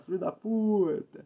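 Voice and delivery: "Filho da puta" said slow, leaning on "puta" with a falling end; slow emphatic ending. Shown as a demonstration. Friend showing how the insult must end, slowly. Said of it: "ao final é importante".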